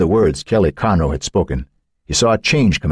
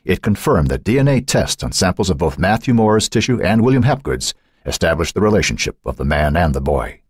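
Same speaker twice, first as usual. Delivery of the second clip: plain reading voice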